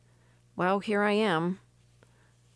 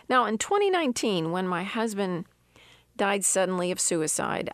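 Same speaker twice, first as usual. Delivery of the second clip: usual speaking voice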